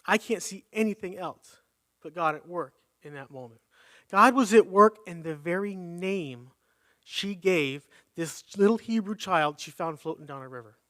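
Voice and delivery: usual speaking voice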